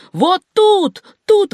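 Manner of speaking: high, loud pointing cry; urgent high shout; excited call. A narrator's reading. Simpson points out the whale.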